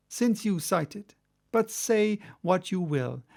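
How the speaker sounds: usual speaking voice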